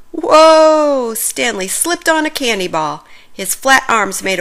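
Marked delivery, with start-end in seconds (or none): 0.1-1.1 loud, high cry of surprise; startled whoa. Acted. Stanley slipping on a candy ball, loud startled cry. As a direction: yelp as your feet go out.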